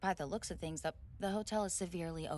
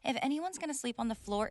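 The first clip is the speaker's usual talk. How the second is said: normal talking voice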